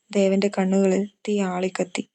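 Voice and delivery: ordinary delivery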